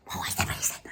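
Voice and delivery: hushed, mocking replay of the line; a soft sneering echo; mock whisper. Shown as a demonstration. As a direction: murmur it back like a parody. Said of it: "mocking repeat".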